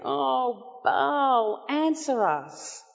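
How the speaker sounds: high, pleading call to a false god, drawn out; desperate cry to Baal. Retold or imitated. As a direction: call out to Baal, begging him to answer.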